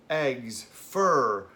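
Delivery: normal talking voice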